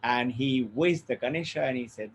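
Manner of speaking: ordinary delivery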